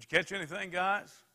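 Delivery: high, loud call across the water. Acted. Jesus calling to the disciples fishing, loud call. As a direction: shout it out to the boat.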